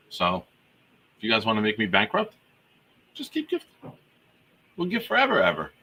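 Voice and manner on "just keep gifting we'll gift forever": high squeaky voice. Acted. Joking plea, high squeak.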